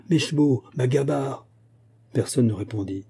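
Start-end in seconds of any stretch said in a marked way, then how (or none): 0.1-1.5 understated cry for servants, pitch lifted; mild frantic call. A narrator's reading. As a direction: call out urgently without really shouting. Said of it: "se pendit à la sonnette et cria".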